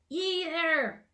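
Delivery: loud, high booming hail; bellowing gnome. A narrator's reading. Gnome king hailing Twig from the statue, bellowing loud. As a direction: bellow out like a puffed-up tiny king. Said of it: "bellowed the gnome".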